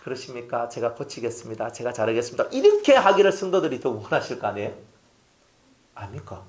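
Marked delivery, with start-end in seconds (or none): 0.0-2.4 much softer, lower, smiling and meek; smiling humble reply. Retold or imitated. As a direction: yield politely with a smile. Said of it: "웃으면서". (웃으며 공손하게 낮추는 말투)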